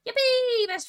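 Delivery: squealing cheer. Acted. Wolf squeals with mock glee to the pig.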